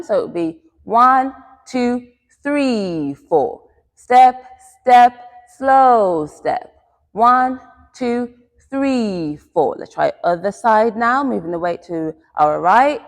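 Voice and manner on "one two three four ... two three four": two punchy beats, a stretched third, dropped fourth; raised, measured counting on the beat; quick-quick-slow count. Shown as a demonstration. Teacher counts the class through the step, in rhythm. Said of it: "use the quick, quick, slow rhythm".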